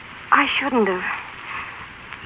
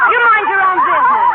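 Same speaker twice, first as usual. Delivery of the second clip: higher, louder scolding; sharp rebuke; 10 semitones higher, 5 dB louder than usual. Acted. Mill hand snapping at a gossiping friend, sharp and loud. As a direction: shut the gossip up.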